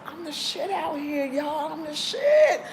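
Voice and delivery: high, slow, swaggering boast, peaking at the end; hammed-up bragging. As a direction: strut it like you own the place. Retelling her own boast video.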